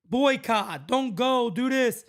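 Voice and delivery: mock chant of angry fans; mocking the boycotters. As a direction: parrot the fans' demands to mock them. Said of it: "for the people saying boycott".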